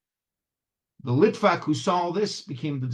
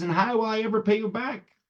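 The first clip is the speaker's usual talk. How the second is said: high, plaintive question; lightly acted despairing woman; woman crying out; 8 semitones higher than usual. A narrator's reading. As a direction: cry it out, pitched up. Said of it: "the woman cries and says".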